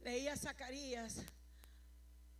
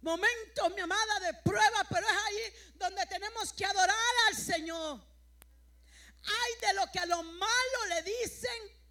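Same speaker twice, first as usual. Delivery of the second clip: raised, higher-pitched pulpit delivery; fervent preaching; 6 semitones higher, 10 dB louder than usual. Acted. Preacher urging the church to worship in trials, fervent preaching. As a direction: exhort the congregation with emphasis.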